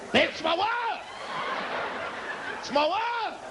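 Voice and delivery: high, alarmed cry for his wife; panicked call. Retold or imitated. Man slapped by the kids, calling his wife high.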